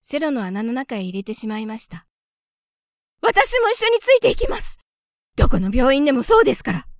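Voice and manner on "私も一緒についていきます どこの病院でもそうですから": much higher, louder, pleading mouse voice; cling to her child, begging; anxious mother mouse. A narrator's reading. Mother mouse begging Gauche to take her too, high and urgent.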